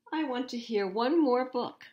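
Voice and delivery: loud, high bedtime protest; excited mock shout. A narrator's reading. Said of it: "does he shout".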